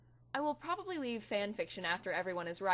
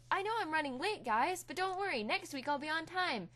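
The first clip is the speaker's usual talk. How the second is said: mock perky self-quote; 9 semitones higher than usual. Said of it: "last week I said".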